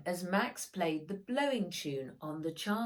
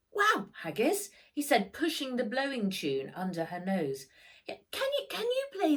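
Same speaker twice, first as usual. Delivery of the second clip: put-on excited boy; 7 semitones higher, 4 dB louder than usual. A narrator's reading. Excited boy asks dog to play.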